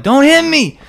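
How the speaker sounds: very high, loud squealed plea; hammed-up frightened falsetto shriek; mock terror. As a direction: imitate someone cowering from a punch. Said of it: "They're like".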